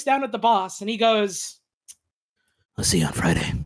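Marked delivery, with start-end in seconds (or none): 2.8-3.5 much lower, deadpan one-liner; deadpan cool line. Acted. Jerry looks down at the beaten boss, low deadpan farewell. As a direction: drop it low and cool, like an action hero.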